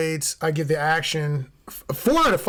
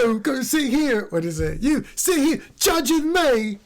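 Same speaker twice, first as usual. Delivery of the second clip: a slow, much higher mock Tom Hardy voice; Tom Hardy impression; 7 semitones higher than usual. Host imitating Tom Hardy for his co-host, slow and high. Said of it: "That's Tom, Tom Hardy".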